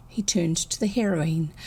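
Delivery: ordinary delivery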